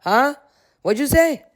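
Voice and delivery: imitating someone caught off guard, pitch jumping up; high, puzzled mock questions; mock distracted. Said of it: "Shane is distracted".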